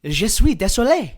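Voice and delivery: swooping French outburst; apologetic French speaker. Acted. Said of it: "looks at Selena from the ground and says".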